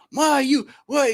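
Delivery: high, loud mock yell from the crowd. Father yelling at his son from the crowd, mock yell. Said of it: "He's just yelling".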